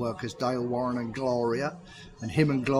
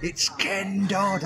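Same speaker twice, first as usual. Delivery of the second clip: slow teasing reveal, higher; string out the big news; drawn-out reveal; 6 semitones higher, much slower than usual. Retold or imitated. Agent teases the news, slow drawn reveal. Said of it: "he kept me hanging on".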